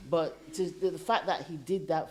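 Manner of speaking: speaking through loud laughter; laughing while talking. Real, not acted. Laughing at husband's gift.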